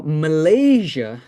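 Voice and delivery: MALAYSIA drawn out, stressed LAY and a zh sound; contrasting pronunciation. Shown as a demonstration. Teacher setting the country apart from militia, slow and exact. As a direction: stretch MALAYSIA slowly, ma-LAY-zha. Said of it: "Malaysia is different".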